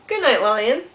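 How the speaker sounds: loud cheery good night; bright called-out good night; calling good night. Acted. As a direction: call it out to the lion. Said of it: "Zookeeper Joe says".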